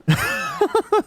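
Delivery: a sudden high burst of laughter; cracking up for real. Real, not acted. Cracking up at a memory.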